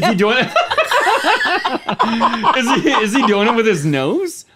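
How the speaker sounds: laughing through the question; giggling while asking. Real, not acted. Laughing at a bad roar attempt.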